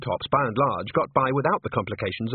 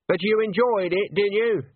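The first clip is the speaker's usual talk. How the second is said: raised, higher-pitched call; mildly raised calling voice; 10 semitones higher, 3 dB louder than usual. A narrator's reading. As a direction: call it through to the next room. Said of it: "she called out".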